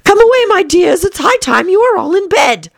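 high, anxious canary calling; a mother canary fretting over her children; trembling canary. A narrator's reading. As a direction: call the little ones in a worried trill. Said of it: "in a trembling voice".

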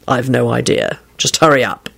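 low man's voice; impatient boyfriend. A narrator's reading. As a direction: urge her on in a low voice. Boyfriend urges her to come.

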